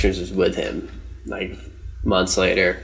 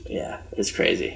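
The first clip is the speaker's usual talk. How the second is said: breathy whisper, much softer; 3 semitones lower, 10 dB quieter than usual. Real, not acted. Remembering the scary trip to his friend, whispered. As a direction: let it fall to a hushed whisper.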